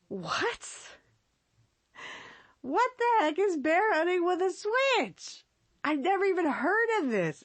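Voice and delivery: mock disbelief. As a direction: act baffled by something absurd. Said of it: "you're just like".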